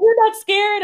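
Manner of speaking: lightly voiced frightened brother; high, alarmed brother. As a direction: ask it like a spooked little boy.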